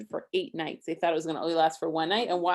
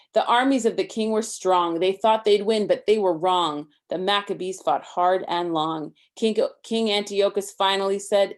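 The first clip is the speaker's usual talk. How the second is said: lively rhyming read-aloud, a bit higher and louder; story reading; 3 semitones higher, 8 dB louder than usual. A narrator's reading. Parent reading a Hanukkah book to Isaac, lively rhyme. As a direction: read the rhymes to a child with bounce.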